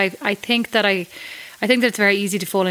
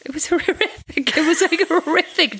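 laughing through the words, pitched up high; real laughter while complaining; 9 semitones higher than usual. Real, not acted. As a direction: giggle out your disgust at the awful lunch. Laughing over the awful lunch.

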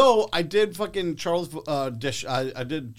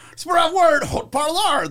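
put-on loud, high French in an Anglo accent; heavy Anglo accent; 12 semitones higher, 8 dB louder than usual. Actor delivering his commercial line, loud heavy Anglo accent. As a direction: project the French with thick English sounds. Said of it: "that's a heavy Anglo accent".

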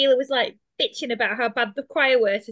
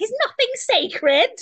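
much higher, slow mock outrage; scandalised nun; 10 semitones higher, 3 dB louder, much slower than usual. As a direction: drag it out like a shocked old nun. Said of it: "Sister Evangelina was like".

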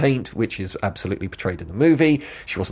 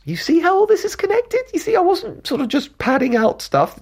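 high teasing squeak. Acted. Lecturer teasing viewers that it all connects, high mock squeak. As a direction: jump way up high into a playful mock voice.

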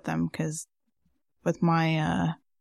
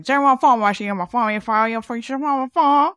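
put-on thick Southern accent; 5 semitones higher, 6 dB louder, faster than usual. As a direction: mimic a hard-to-follow country man. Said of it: "He had such a thick accent".

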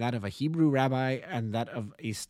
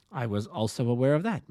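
ordinary delivery, nothing marked

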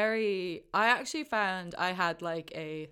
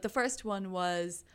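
usual speaking voice

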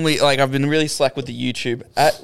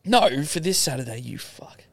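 hushed teasing whisper; whispered insult; 5 dB quieter than usual. Real, not acted. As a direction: breathe the insult out quietly. Mock-insults cohost under breath.

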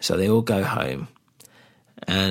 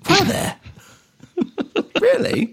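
sarcastic surprise. Real, not acted. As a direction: feign shock, squeaking high.

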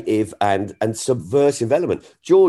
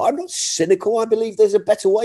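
passionate protest; 11 semitones higher, faster than usual. Real, not acted. Comic insisting to critics that he believes in a better world, passionately. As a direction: fire back with conviction.

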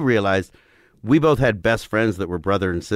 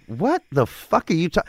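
higher-pitched retold disbelief; mock-baffled reaction, pitched up; incredulous; 6 semitones higher than usual. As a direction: act out being baffled by an absurd claim. Retelling his disbelief at gator story.

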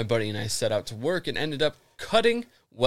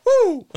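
high woo cry; 10 dB louder than usual. Pretend. Imitating the roar.

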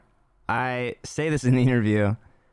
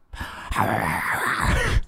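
burst of laughter. Real, not acted. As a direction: crack up at the end. Laugh after the warm-up.

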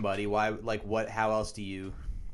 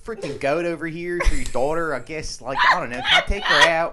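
loud, very high Philly accent, hammed up; a brash Philly suitor offering a goat; over-the-top Philly accent, much higher and louder; 8 semitones higher, 8 dB louder than usual. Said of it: "Do a Philly accent with the goat".

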